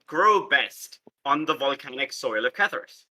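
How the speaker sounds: ordinary talk, nothing marked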